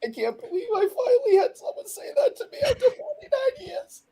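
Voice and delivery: high wailing fake cry; hammed-up laughing sobs; mock sobbing. Mock crying over compliment.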